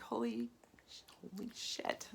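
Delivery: hushed, whispered holy shit; stunned realization. Retold or imitated. Realizing why she falls asleep, whispered awe. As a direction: let it dawn on you in a whisper. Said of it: "I'm like".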